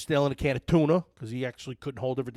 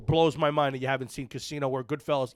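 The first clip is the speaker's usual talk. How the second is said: normal talking voice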